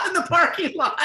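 high, laughing punchline; cracking up, pitch shooting up. Real, not acted. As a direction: squeeze the words out through laughter. Laughing at the hearse story.

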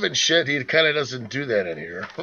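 usual speaking voice

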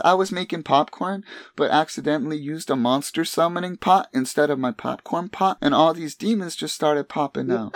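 put-on high sing-song little girl. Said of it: "in her sing-song voice".

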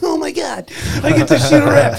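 high, loud giddy squeal with a laugh; high-pitched excited laughing gush; giddy schoolgirl. Retold or imitated. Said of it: "I was like a giddy schoolgirl".

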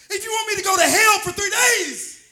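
loud climactic cry; preaching shout. Real, not acted. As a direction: build it to a full shout. Preaching crescendo.

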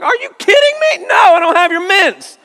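very high, loud hammed-up shout; mock outrage. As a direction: blow up in fake disbelief. Dad's mock outraged reply.

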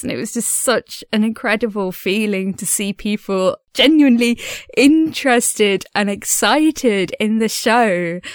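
ordinary talk

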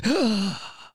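sharp high mock gasp of surprise; high theatrical gasp. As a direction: gasp like a shocked church lady. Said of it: "and they say".

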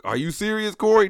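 loud, rising disbelief on the name; mock outraged call to Corey; incredulous yell at cousin. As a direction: shout it like you were just betrayed. Shouting at cousin who ran off.

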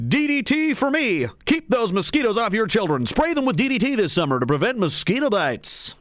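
bright, cheesy vintage ad announcer; chipper 1950s ad. Pretend. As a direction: sell it like an old TV commercial. Mock old cigarette and DDT ads.

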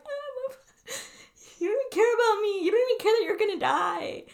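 high, hammed-up tearful whine; mock childhood crying. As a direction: imitate a melodramatic kid wailing at mom. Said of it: "I would cry every night".

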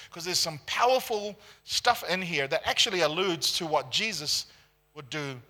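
normal talking voice

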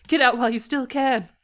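high, loud urging; urgent warning. A narrator's reading. Niall held hostage warning Liz, urgent cry. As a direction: urge Liz out loudly.

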